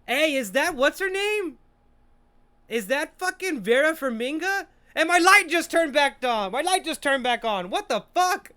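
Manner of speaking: high, loud disbelieving outburst; loud astonishment. Real, not acted. Viewer reacting as the light turns on, loud astonishment. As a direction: yell it out, real surprise.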